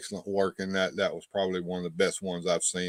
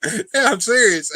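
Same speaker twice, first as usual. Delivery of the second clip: laughing; 12 semitones higher, 8 dB louder than usual. Real, not acted. Laughing at the fake ad.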